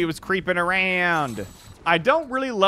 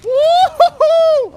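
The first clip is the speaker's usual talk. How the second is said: slow, very high victory whoop; drawn-out high whoop; 11 dB louder, much slower than usual. Real, not acted. Gamer celebrating a kill, high whoop. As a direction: hoot it out in triumph.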